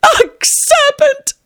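very high falsetto pigeon shriek. A narrator's reading. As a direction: wail in outrage at the top of the pigeon's range. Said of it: "raising its voice to a shriek".

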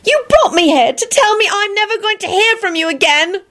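long, very high-pitched wail. A narrator's reading. Elizabeth accusing Lupin of abandoning her, wailing. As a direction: wail the accusation without coming down. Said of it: "Elizabeth wailed".

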